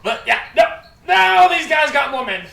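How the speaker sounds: big booming announcement, slowed; loud gruff npc. Acted. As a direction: bellow it out to the table.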